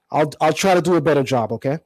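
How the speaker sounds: normal talking voice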